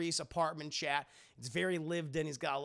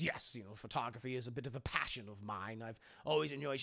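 lower, softer, lightly voiced old man; gruff old man; 5 semitones lower, 4 dB quieter, faster than usual. Henry modestly telling Punky about his photography. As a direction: say it quietly like a lonely old man. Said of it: "he goes".

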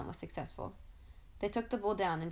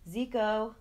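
a loud call from far off; 4 semitones higher, 6 dB louder than usual. Acted. Father calling his son home from a distance, loud call. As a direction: call his name across the grass. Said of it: "his name being called in the distance".

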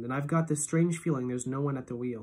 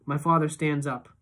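plain reading voice

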